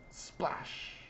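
whispered sound effect of water; whispered splash. A narrator's reading. Narrator imitating the bigger dive, whispering. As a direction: make the word a hushed splash.

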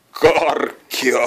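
excited bird voice; candy-struck cry. A narrator's reading. Joni sees the candy, excitedly. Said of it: "Jonin tajuntaan ei mahtunut".

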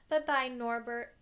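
a hammed-up tearful farewell, slowly; sobbing. A narrator's reading. Hagrid bidding his dragon goodbye, with a mock sob. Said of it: "Hagrid sobbed".

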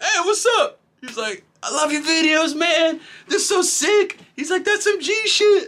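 high, eager street fan; excited fan. Retold or imitated. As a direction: gush like a fan spotting his hero. Stranger fan greeting him.

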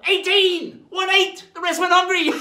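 high, loud hawker-like shout; calling out. Acted. Pirate announces lesson eighteen, high jubilant shout. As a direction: bellow the number with glee.

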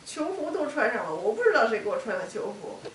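high, loud, agitated outpouring; upset and pushing the words out loudly; real distress. Real, not acted. Distressed account of waking in pain.

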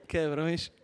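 ordinary talk

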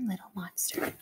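unvoiced hushed whisper; a whispered little monster. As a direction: breathe it like a secret. Title refrain whispered.

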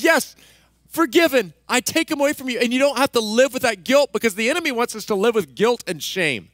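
loud, high, fervent preaching; full-force preaching. Acted. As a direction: preach it out to the whole church.

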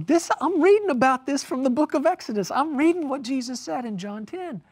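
high, quick, amazed outburst; astonished excitement. Retold or imitated. Preacher retelling his reaction to his friend's news, astonished. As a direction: blurt it out high in disbelief.

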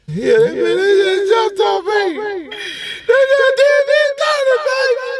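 high wailing sob-story voice; fake crying. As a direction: wail it out like you got robbed.

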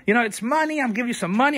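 high, pushy imitation of a rude customer; mock angry customer. Owner imitating a rude dealer who gets mad, high and pushy. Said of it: "then he gets all mad".

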